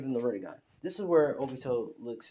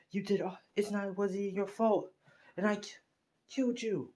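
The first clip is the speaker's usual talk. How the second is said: high-pitched, halting, acted distress; mounting anger; 9 semitones higher than usual. Younger brother realizes he killed his brother, mounting anger. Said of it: "begins to get even more anger".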